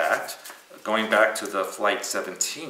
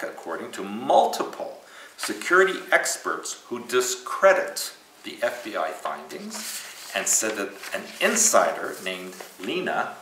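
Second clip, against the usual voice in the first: usual speaking voice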